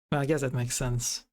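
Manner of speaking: ordinary delivery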